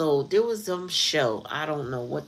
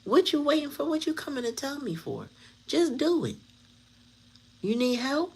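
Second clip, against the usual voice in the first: higher, fed-up imagined comeback; imagined exasperated reply, pitched higher; exasperated retort; 8 semitones higher than usual. Retold or imitated. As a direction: snap back at him, fed up. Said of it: "I would have been like".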